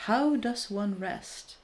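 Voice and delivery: ordinary talk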